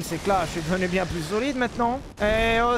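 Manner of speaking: ordinary talk, nothing marked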